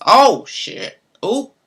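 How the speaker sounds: a sudden loud, high "Oh, shit"; startled curse. Real, not acted. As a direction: blurt it out, caught off guard. Startled by a card drawn.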